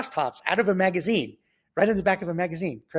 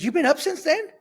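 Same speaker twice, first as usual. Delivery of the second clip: high-pitched mock disbelief; incredulous question; 14 semitones higher, 4 dB louder than usual. Biker to sleepless kid, retold with high incredulity. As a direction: shoot the pitch way up in astonishment.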